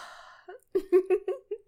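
high bursting giggle. Acted. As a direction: laugh out loud, delighted.

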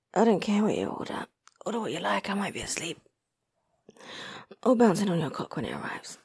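low, soft, spent voice; drowsy murmur. Acted. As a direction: murmur as if nearly asleep. Drowsy talk, might be asleep.

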